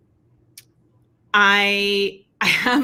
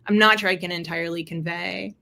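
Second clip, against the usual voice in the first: usual speaking voice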